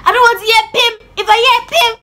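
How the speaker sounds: very high, sharp mimicked threat; stern mother warning. Mom silencing crying child, high-pitched mock warning. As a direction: imitate mom's high, insistent warning.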